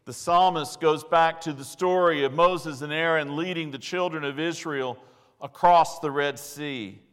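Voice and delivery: ordinary talk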